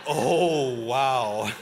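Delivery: laughing amazement at the map; mock surprise. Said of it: "and you go".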